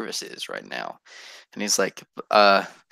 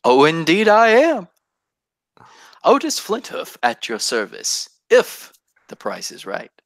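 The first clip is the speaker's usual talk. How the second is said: scheming merchant; 6 semitones higher, 3 dB louder than usual. Acted. GM voicing Otis the merchant.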